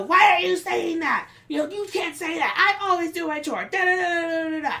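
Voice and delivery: imitating an angry high-pitched rant; mock yelling, high and indignant; retold yelling. Worker acts out angry client defending his chores, mock yelling. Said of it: "yelling and screaming".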